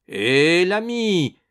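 loud hail, rising high; calling out. A narrator's reading. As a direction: hail the guard to stop him. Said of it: "fit Ragastens". (interpellation forte, voix montante)

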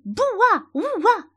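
high, loud, drawn-out mock bark; bow-wow in a put-on high voice; imitated bark. A narrator's reading. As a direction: woof out the words like a daisy playing dog. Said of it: "Il pourrait aboyer".

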